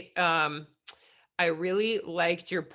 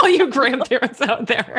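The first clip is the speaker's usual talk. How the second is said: laughing shout-out; 6 semitones higher, 10 dB louder than usual. Real, not acted.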